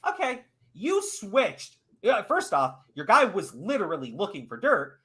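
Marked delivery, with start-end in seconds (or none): 0.1-1.8 slow sarcastic. Retold or imitated. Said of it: "I'm like".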